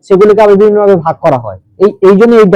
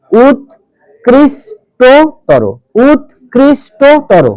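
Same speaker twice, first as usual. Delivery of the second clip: উৎকৃষ্টতর very slow, higher, as ut, krish, to, toro; long pauses inside উৎকৃষ্টতর, pitch lifted; syllable by syllable; 4 semitones higher, much slower than usual. Shown as a demonstration. Teacher demonstrates where the word breaks, slow raised syllables. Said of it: "বিচ্ছেদ তো হবে".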